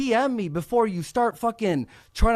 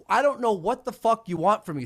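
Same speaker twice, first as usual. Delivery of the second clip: usual speaking voice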